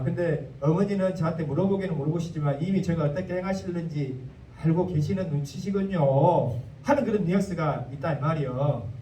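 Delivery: ordinary delivery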